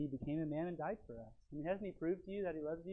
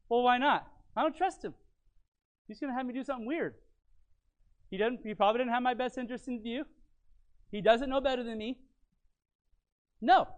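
much higher, louder defiant voice of someone who won't obey; stubborn refuser; 8 semitones higher, 7 dB louder than usual. Acted. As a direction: protest like a suspicious kid refusing. Voicing a distrustful refuser.

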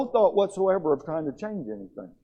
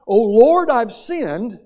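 higher and much louder, a pleading cry of prayer; loud cry to God; 7 semitones higher, 11 dB louder, much slower than usual. Shown as a demonstration. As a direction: call out to the Lord with full force. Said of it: "So our prayer should be something like this".

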